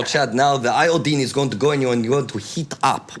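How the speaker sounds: mock Russian-accented medical warning; Russian-sounding accent. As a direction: explain it as a doctor with a Russian accent. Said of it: "now I'm sounding Russian".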